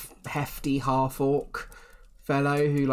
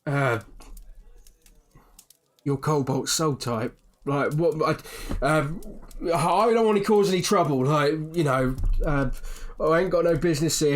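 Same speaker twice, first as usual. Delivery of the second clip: nervous barman; 3 semitones higher, 4 dB louder than usual. Acted. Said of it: "he kind of whispers in".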